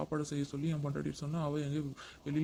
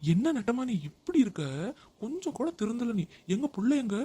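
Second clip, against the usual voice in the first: angry scolding; 7 semitones higher, 5 dB louder than usual. Acted. Husband scolding wife for not changing, angry. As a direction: tell her off for never changing.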